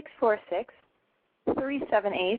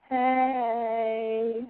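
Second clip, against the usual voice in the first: a lightly put-on seductive hey; mock sexy voice. Radio host to her listeners, a playful mock sexy hello. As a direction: tease the listeners with a hammed-up sexy greeting. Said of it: "let me do my sexy voice".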